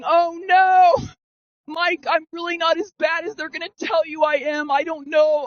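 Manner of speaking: high, pleading mock panic; acting out an anxious plea to a mentor; retold dread. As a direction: wail it in a high voice, then plead. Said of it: "I was feeling all those emotions".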